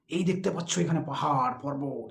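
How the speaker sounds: put-on teacher showing children mountains, excitedly; excited storytelling demo. Shown as a demonstration. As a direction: lively voice as if showing children a view. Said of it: "excited হয়ে যাব".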